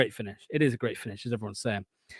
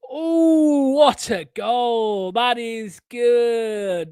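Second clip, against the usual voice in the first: high, drawn-out celebration, very slow words; thrilled goal cry; 7 semitones higher, 5 dB louder, much slower than usual. Real, not acted. Fan seeing Bilal El Khanous score, thrilled cry. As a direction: stretch out the delight in a high, loud cry. Reacting live to Leicester goal.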